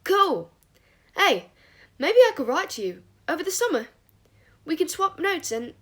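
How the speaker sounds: high, loud, bubbly enthusiasm; relentlessly cheerful. A narrator's reading. Christopher offering to write to Remus, relentlessly cheerful. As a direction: gush on eagerly about summer plans. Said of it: "relentlessly cheerful".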